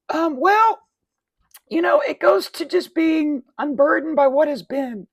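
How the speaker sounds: very high, loud put-on voice; hammed-up high-pitched evasive politician; mock politician's answer. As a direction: dodge the question in a silly falsetto.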